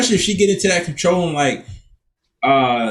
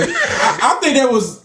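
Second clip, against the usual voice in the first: usual speaking voice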